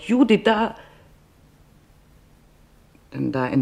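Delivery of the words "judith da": a quick high call of surprise; sudden cry to her daughter, spotting a face; sudden startled call. Retold or imitated. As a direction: blurt it out high, pointing someone out. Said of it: "fuhr mir plötzlich heraus".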